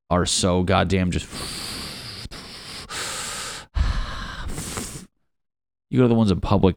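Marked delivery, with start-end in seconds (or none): 1.3-5.0 long, soft whooshing breath; weak flush imitation. Comic showing how home toilets flush, weak whoosh.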